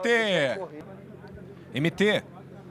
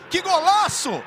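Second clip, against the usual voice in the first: excited exclamation; 5 semitones higher, 3 dB louder than usual. Real, not acted. Repeated exclamation, first take.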